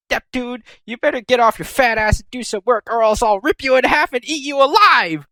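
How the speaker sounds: high mock-menacing threat, rising to a yell; Histoire threatening. Histoire scolding lazy Neptune, high mock-menacing voice. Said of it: "Histoire is all like".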